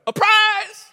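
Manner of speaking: put-on high, loud, excited little-boy shout; grandson's shout. Grandfather imitating grandson announcing a surprise, high excited shout. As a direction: cry it out like an eager grandson. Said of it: "and he'll go".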